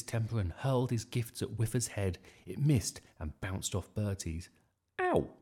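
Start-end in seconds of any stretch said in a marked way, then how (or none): 5.0-5.3 high, sudden yelp of pain; loud "ow". A narrator's reading. Bertie hit by the thrown gift, loud yelp. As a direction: yelp it out sharply. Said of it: "he said loudly".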